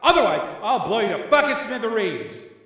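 high, loud, furious tirade; yelled threats. Acted. Gangster gives agent ten seconds, yelling down. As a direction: yell down an ultimatum in rage. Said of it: "Tommy yelled down to the arena".

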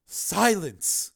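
imperious one-word command. A narrator's reading. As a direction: cut him off with authority. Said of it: "she commanded".

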